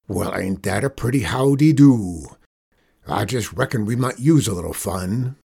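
amused outlaw boss. Acted. Outlaw boss taking up the boy's dare, amused. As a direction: play the tickled gang leader.

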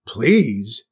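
loud, affronted one-word question; indignant spoiled queen echo; echoing in disbelief. A narrator's reading. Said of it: "echoed the queen".

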